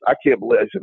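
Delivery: usual speaking voice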